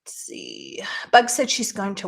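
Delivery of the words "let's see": whispered to herself. Real, not acted. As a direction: breathe it as a private whisper. Muttering to herself.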